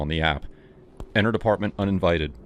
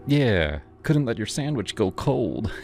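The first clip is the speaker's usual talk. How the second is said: higher, sleazy grinning voice; creepy delivery guy; 5 semitones higher than usual. Acted. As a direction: play him smug and off-kilter.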